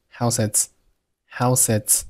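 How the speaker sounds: "house its" run together as how-sets; slow, linked "house its"; consonant-vowel linking. Shown as a demonstration. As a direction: carry the s of "house" onto "its". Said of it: "就发生辅元连读".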